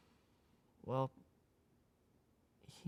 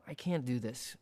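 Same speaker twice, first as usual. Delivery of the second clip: weary sigh. Acted. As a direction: breathe it out, worn down. Mother's words, sighing.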